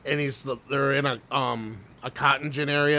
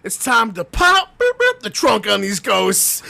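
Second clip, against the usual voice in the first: high, loud, slow goofy hype voice; hammed-up excited ghost hunter; mimicking the dude; 8 semitones higher, 8 dB louder than usual. Friend retelling a ghost hunter's hype line, high and loud. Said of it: "the one dude goes".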